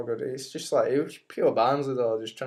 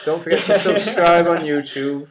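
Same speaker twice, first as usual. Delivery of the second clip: loud corrected outro; 3 semitones higher, 9 dB louder than usual. Real, not acted.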